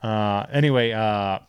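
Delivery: normal talking voice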